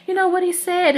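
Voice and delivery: high, squealing mock excitement; hammed-up excited first lady. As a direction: gush like a giddy proud mom in a put-on voice. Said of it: "the first lady who was like".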